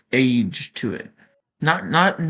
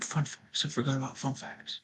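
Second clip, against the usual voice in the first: muttering; 11 dB quieter than usual. Real, not acted. Mutters after forgetting fun facts.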